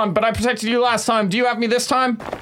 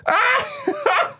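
high cackling laughter; being tickled; 7 semitones higher, slower than usual. Acted. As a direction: giggle through the burning. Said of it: "watching this unfold".

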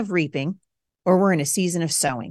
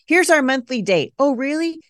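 higher-pitched sarcastic disbelief; mock quote; 7 semitones higher, 3 dB louder than usual. Wife mocking the monthly date idea, sarcastic. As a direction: scoff at a smug announcement.

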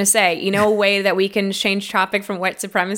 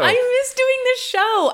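high, slow echo of the line; mocking echo; slower than usual. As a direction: parrot it back, higher and slower. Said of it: "echoing Jeff's line".

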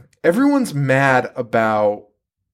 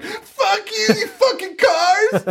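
high, hammed-up yell; mock scream at cars; much slower than usual. Lori screams at cars while driving, high mock yell. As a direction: shriek at passing cars, pitched way up. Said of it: "She's screaming at cars".

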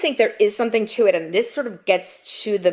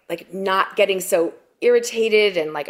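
ordinary talk, nothing marked

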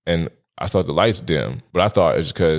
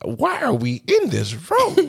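pitched way up, laughing in shock; high disbelief; 12 semitones higher, slower than usual. Retold or imitated. Retelling the club scene, high shocked squeal. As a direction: squeal it, scandalised and giggling. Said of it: "I'm like".

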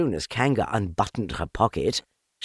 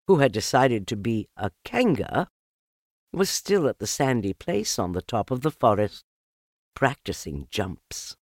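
plain reading voice

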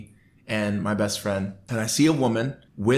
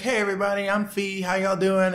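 chirpy handler greeting; 10 semitones higher than usual. Retold or imitated. Handler Fiona greets the fans.

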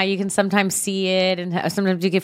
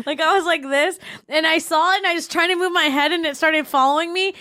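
higher, laughing, fast build of a spooky tale; excited, raised pitch storytelling; excited retelling; 6 semitones higher, faster than usual. Retold or imitated. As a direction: tell it breathless and amused, half laughing. Retelling being scared by ghost.

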